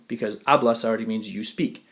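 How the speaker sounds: ordinary talk, nothing marked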